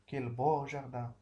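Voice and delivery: stretched-out words of admiration; admiring. Shown as a demonstration. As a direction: praise the garden slowly. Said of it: "un sentiment d'admiration".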